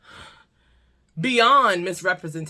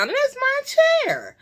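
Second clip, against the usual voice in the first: much higher, outraged squeak; indignant squeal. Real, not acted. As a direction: shriek it in disbelief. Indignant rant about broken chair.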